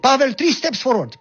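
high, loud military command; barked order. Retold or imitated. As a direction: call him out in front of the row. Captain commanding in the field.

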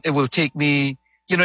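ordinary talk, nothing marked

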